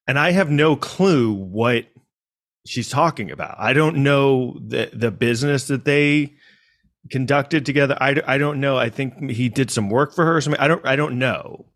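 ordinary talk

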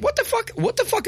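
mock outrage. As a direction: squeak up in disbelief at the reply. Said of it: "I'm like".

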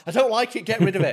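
high, fussy protest; hammed-up squeamish demand; acted indignant complaint. Acting complaint about poison.

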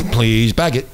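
machine voice after a laugh; robotic store prompt; self checkout prompts. Retold or imitated. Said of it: "that's what it says".

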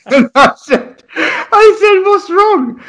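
high, laughing, almost teary. Real, not acted. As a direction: fight through the giggles to ask. Laughing while retelling.

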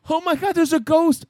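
high, startled fake fright at a ghost; mock scared. Friend retelling seeing a pale kid, mock scared squeal. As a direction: squeal it like you just saw a ghost. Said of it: "I was like".

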